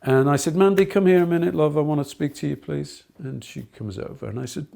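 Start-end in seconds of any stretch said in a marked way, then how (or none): 0.5-3.0 louder and higher, quick call across the room. Retold or imitated. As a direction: call her over before playtime. Teacher calls pupil at playtime.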